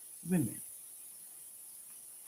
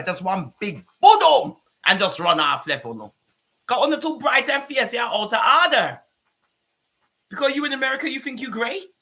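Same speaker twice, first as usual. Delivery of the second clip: loud, higher tirade; real anger building; furious scolding; 6 semitones higher, 6 dB louder than usual. Real, not acted. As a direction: lay into her hard. Ranting at a woman online.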